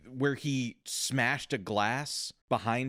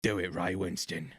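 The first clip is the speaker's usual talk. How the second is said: Cockney impression; 4 semitones lower than usual. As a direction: stretch the vowels into thick Cockney. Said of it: "I think it was more like".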